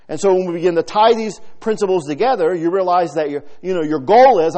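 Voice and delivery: loud, high, driving sermon voice; fervent preaching. Acted. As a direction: preach it out with full fire. Preaching at full force.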